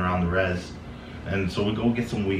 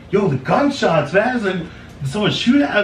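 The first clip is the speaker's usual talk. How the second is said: alarmed; 12 semitones higher, 4 dB louder than usual. Retold or imitated. Corey panicked about gunshots.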